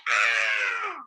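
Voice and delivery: short low shouted ah; mock kids' scream of disgust. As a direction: yell out in horror at the sight. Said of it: "they went".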